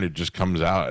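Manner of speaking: normal talking voice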